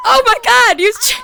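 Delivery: high, loud squeal of shock; shocked oh my god. Real, not acted. Streamer squealing at her friend's avatar. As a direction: shriek it out in surprise.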